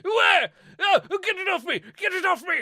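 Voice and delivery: high, hammed-up squeal of panic; mock panic. News cat panics on air over a spider.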